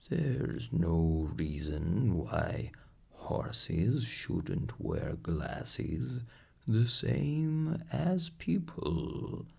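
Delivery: slow, worn-out plough horse voice; a weary old horse grumbling about his vet. A narrator's reading. Plough horse complaining to the doctor, slow horse voice.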